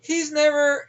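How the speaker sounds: ordinary talk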